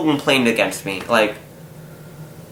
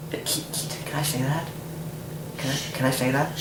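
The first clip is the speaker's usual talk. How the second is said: hushed aside; 12 dB quieter than usual. Real, not acted. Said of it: "asking if it's allowed".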